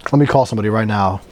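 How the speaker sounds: ordinary delivery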